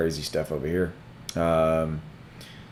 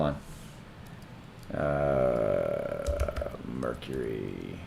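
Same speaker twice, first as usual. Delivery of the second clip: whispered muttering; 10 semitones lower, 10 dB quieter, much slower than usual. Real, not acted. Muttering while searching.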